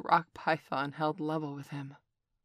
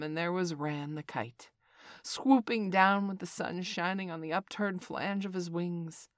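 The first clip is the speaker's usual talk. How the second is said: ordinary delivery, nothing marked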